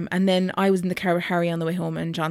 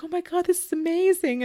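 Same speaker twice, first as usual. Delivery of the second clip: midwife friend gushing; 11 semitones higher than usual. Retold or imitated. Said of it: "one of my friends is a midwife".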